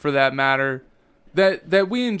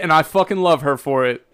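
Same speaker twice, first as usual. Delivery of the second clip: ordinary delivery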